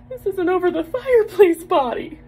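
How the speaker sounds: hammed-up affront, voice shooting up; mock outrage. Wife ranting about being called a nine-point, indignant.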